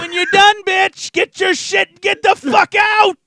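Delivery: loud laughing shout, high; yelling. Pretend. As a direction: yell it out while laughing. Imagined yelling at cheating partner.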